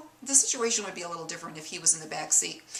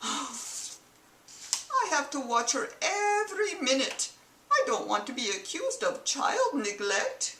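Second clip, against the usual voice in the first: sighing, slow and softer, higher mock accent; weary aside in a bad Mid-Eastern accent; husband grumbling to himself, mock Middle Eastern accent; put-on Middle Eastern accent; 6 semitones higher, 4 dB quieter, slower than usual. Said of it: "excuse the accent".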